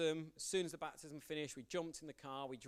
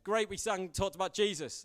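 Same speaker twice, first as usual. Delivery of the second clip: higher, much louder, gushing mock enthusiasm; over-eager cheer; 5 semitones higher, 9 dB louder than usual. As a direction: overdo the churchy cheer. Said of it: "That's a bit intense".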